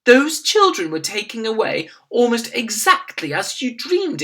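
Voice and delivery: a flustered maid to her mistress; maid speaking. A narrator's reading. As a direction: nervously explain to the Queen. Maid explains to the Queen.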